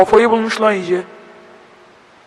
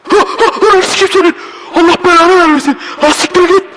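high nervous laughter into frightened cursing; panicked laughing; 9 semitones higher than usual. Real, not acted. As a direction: laugh in terror and swear it away. Jump scare, panicked laughing curses.

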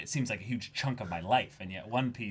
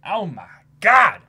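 excited; 9 semitones higher, 15 dB louder than usual. Real, not acted. Hitting chapter one thousand.

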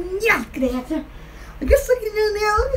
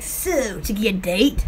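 low, soft imitation of a man; pilot flirting; 8 semitones lower, 4 dB quieter than usual. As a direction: play the pilot asking her out, dropping your voice. Pilot voiced, asking for date.

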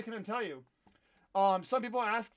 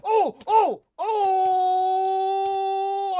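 loud, high yelps of alarm; shocked shout; 11 semitones higher, 14 dB louder, much slower than usual. Real, not acted. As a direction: yell out in a panic as you get hit. Dying in the game, shouting.

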